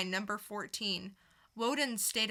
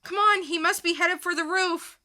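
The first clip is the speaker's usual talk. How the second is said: urgent chase; 7 dB louder than usual. Acted. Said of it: "dramatic reading".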